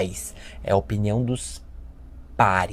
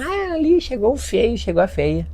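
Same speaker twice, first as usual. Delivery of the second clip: much higher teasing jeer; mocking schoolkids; 12 semitones higher than usual. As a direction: sneer it like a playground bully.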